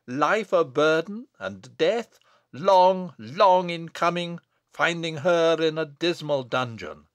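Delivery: loud, ringing, doom-laden declamation; prophetic. Acted. Abbot dooms the witch's descendants, prophetic thunder. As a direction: hammer out each phrase of the curse. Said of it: "as if filled with a prophetic spirit".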